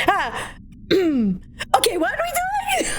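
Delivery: high, awkward, flustered voice; flustered squeak. Acted. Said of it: "retreats back like".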